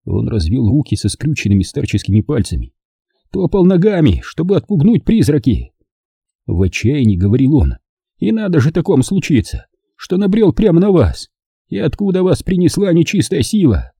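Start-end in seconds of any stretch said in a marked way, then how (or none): 3.3-5.7 put-on higher, worn old man's voice. A narrator's reading. Captured old man explains himself to partisans, higher old voice. 8.2-13.9 put-on higher old voice on the edge of tears; near tears. A narrator's reading. Old man grieves to partisans, a hint of tears. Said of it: "Едва не плача горевал он".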